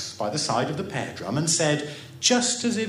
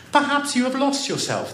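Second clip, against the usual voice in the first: ordinary delivery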